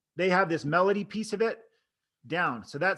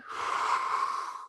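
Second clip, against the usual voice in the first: demonstrated breath out, voiceless; long exhale; 5 dB quieter than usual. Shown as a demonstration. Coach showing trainees air coming out, long exhale. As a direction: let the air flow steadily out. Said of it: "Only speak when air is coming out".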